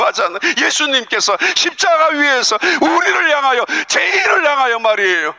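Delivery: high, ringing impassioned preaching; fervent preacher's climax; preaching at full force. Acted. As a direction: pour it out fervently.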